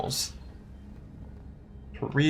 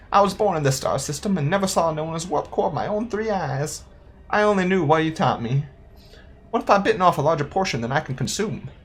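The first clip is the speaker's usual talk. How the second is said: higher-pitched character voice; earnest young apprentice; 7 semitones higher than usual. Acted. Player voices an apprentice's log about a missing mentor, higher and wistful. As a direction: read it as an unsure apprentice, pitched up.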